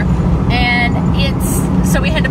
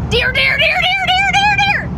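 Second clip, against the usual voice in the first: frantic deer alarm. Retold or imitated. Wife mocking husband's panic spotting a deer, frantic high shout. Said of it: "like he did the time we saw a deer and went".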